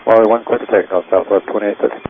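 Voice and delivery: crackly radio transmission; radio static bursts. Real, not acted. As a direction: pilot heard over the radio. Pilot radio readback.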